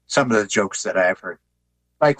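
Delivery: ordinary talk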